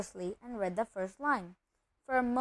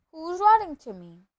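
higher, louder, urgent question; insistent questioning; 6 semitones higher, 7 dB louder than usual. Acted. Nephew confronting his uncle in the cupboard, insistent. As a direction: press for an answer.

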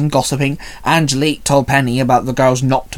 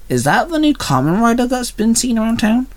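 put-on curious girl voice; 9 semitones higher than usual. A narrator's reading. Curious schoolgirl voice, Angelique.

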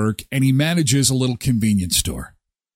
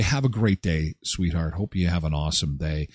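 much lower, softer sweet farewell; tender message; 9 semitones lower, 5 dB quieter than usual. Retold or imitated. Husband wishing Tracy a good day, soft and low. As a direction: say it gently to your wife. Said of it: "talk to Tracy and say".

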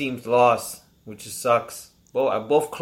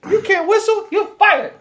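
high squeaky boss voice; a lightly voiced angry boss; mock boss; 5 dB louder than usual. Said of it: "They're like".

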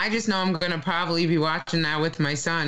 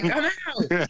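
scared exit line; 7 semitones higher than usual. Retold or imitated. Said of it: "I'll be like".

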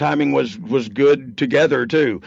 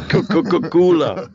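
stuttering, louder and higher mimic of his friend; mock echo; 6 semitones higher, 4 dB louder than usual. Teasing his co-host by parroting cooler, hammed-up. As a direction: tease him by echoing the word. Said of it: "Nothing cooler".

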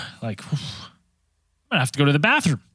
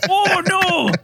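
high, drawn-out cry of dread; high-pitched retold panic; mock panic; 9 semitones higher, slower than usual. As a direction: wail it as disaster looms. Retelling mounting bathroom panic.

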